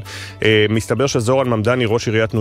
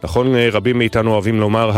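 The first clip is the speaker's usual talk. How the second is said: ordinary talk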